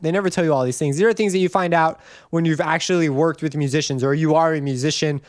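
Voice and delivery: ordinary talk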